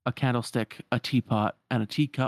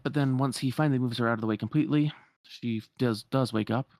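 usual speaking voice

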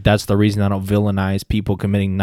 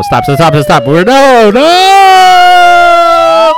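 loud, rising panicked yell; yelling stop; 12 dB louder, much slower than usual. Real, not acted. Host yelling to stop the wrong soundtrack, loud and rising. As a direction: shout to cut the music off.

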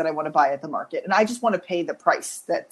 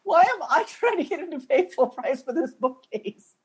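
exasperated; 6 semitones higher than usual. Retold or imitated. Retold inner self-reproach.

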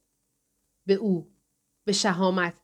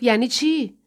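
louder, higher angry challenge; testy, raised-voice question; aggressive; 4 semitones higher, 5 dB louder than usual. A narrator's reading. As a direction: throw it back as an angry demand. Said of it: "با پرخاش گفت".

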